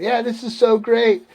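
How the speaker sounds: high, elated re-enacted cheer; jazzed cheer. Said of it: "we're just all jazzed up".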